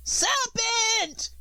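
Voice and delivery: very high shrill bird shriek; screaming pigeon. A narrator's reading. Pigeon screams at Alice, high frantic shriek. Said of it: "screamed the pigeon".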